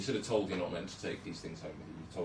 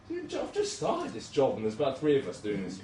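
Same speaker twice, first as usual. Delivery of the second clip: exasperated disbelief; 6 semitones higher, 6 dB louder than usual. Retold or imitated. Junior doctor retelling his panic mid-resuscitation, high and incredulous. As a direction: stretch it out, overwhelmed new doctor.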